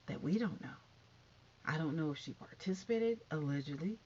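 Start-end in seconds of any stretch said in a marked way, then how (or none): none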